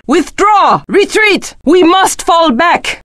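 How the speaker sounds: high-pitched cries to withdraw; urgent retreat call. Acted. Warrior ordering allies to fall back, high urgent call.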